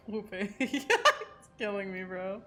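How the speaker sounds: ordinary talk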